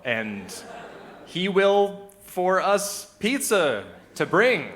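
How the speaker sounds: loud, high stammering hunt for words; hammed-up struggling beginner; German-speaking toddler. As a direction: labour through it slowly, stretching each word. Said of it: "a German-speaking toddler".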